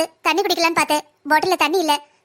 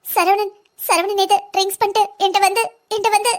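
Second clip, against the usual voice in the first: halting, tearful disclosure; acted sobbing, words repeated; crying; 3 semitones higher than usual. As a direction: break off as if too upset to go on. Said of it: "எதுவா இருந்தாலும் அழாம சொல்லு".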